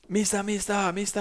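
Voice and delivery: put-on high, eager child voice calling mister; imitating little kids. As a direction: pitch up like small excited kids calling out.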